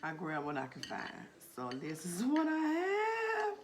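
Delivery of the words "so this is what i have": much higher, louder big reveal; excited reveal. Real, not acted. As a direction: show it off, thrilled. Proudly shows the food.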